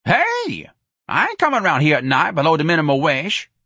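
high yelped hey, then indignant refusal; protesting Jones character. A narrator's reading. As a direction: object loudly and high.

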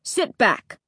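mildly irritated order; a brief snapped command; snapping. A narrator's reading. Dad orders son off his seat, snapping. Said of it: "he snapped".

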